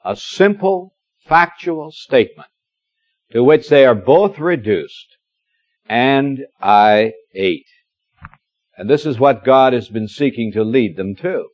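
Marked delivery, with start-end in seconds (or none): 5.9-7.7 very slow, each word weighed; slow emphatic confession; slow, deliberate. Acted. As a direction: lay the words down one by one. Repeating the confession.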